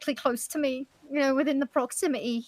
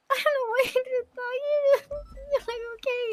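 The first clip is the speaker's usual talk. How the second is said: nervous laugh; 9 semitones higher than usual. Real, not acted. Apologising, then laughing nervously.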